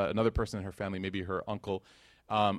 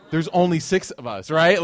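incredulous; 5 semitones higher, 9 dB louder than usual. Retold or imitated. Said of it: "And I was like".

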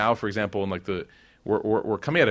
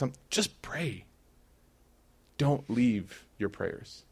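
gentle plea; 4 dB quieter, much slower than usual. Acted.

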